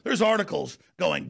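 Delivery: ordinary talk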